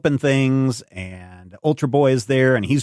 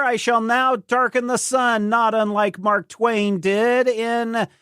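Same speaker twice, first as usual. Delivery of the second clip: grand proclamation; 10 semitones higher than usual. Retold or imitated. Dream Girl threatening to darken the sun, mock-grand and high. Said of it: "she's like".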